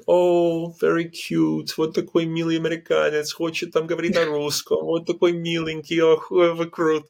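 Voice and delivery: high, sugary talking-down to a foreigner; patronizing. Retold or imitated. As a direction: coo at him like a cute child trying. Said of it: "very patronizing".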